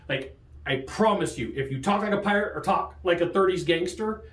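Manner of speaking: usual speaking voice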